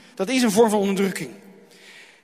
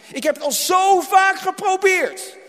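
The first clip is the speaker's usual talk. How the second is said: voiced despair; 7 semitones higher, 3 dB louder than usual. Voicing a despairing person.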